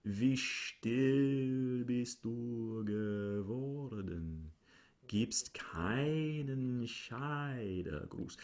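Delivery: slow, soft, hushed monotone. Shown as a demonstration. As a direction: hypnotic cabaret monotone, slow. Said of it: "you are at least on a monotone".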